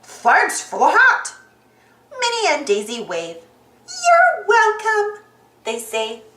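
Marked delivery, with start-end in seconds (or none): 0.0-1.2 loud, slow cartoon Pete; big put-on character voice; calling out. A narrator's reading. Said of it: "he calls". 4.0-5.2 high, loud, drawn-out chorus; high cartoon singsong; singsong reply. A narrator's reading. Minnie and Daisy call back.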